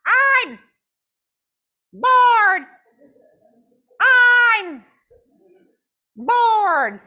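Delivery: high loud barked I'm bored; dog howling I'm bored; barked words. Retold or imitated. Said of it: "Usually that's a bark, like".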